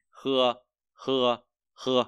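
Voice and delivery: "khưa" as Mandarin h, lightly rasped; Chinese h, light kh. Shown as a demonstration. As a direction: breathe a gentle kh in "khưa". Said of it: "đọc là khờ nhẹ".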